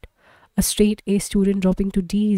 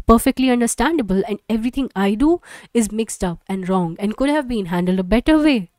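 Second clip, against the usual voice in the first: bitter tirade; 3 semitones higher, 4 dB louder than usual. Acted. Wife lashing out at her husband over their son. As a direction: let the resentment spill out fast.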